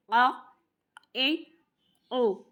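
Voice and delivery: आ ए ओ pushed from the middle of the throat; teacher demo of throat vowels. Shown as a demonstration. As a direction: voice आ ए ओ from mid-throat. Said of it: "बीच वाले गले से".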